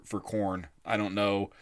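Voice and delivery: ordinary talk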